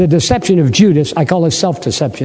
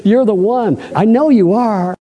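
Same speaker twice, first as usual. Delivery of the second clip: put-on servant girl voice; 4 semitones higher than usual. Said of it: "The little lady said".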